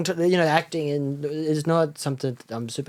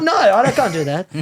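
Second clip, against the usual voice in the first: alarmed daughter; 6 semitones higher, 5 dB louder than usual. Retold or imitated. Daughter told to weave gold.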